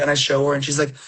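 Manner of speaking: ordinary talk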